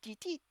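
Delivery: high squeaky toy squeaks; rubbery high squeaky toy. Shown as a demonstration. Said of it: "you make the squeaky toy noise".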